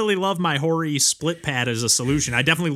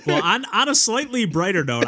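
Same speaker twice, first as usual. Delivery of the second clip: laughing; 8 semitones higher than usual. Real, not acted. Laughing transition to new topic.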